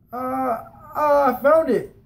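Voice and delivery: put-on high, halting caught-kid voice; scared stammer. Boy caught spending stolen quarters, stammering scared excuse. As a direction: stammer out a weak lie, half crying.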